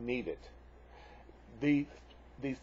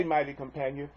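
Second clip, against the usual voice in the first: usual speaking voice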